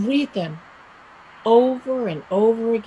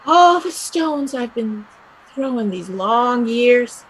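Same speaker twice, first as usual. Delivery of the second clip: ordinary talk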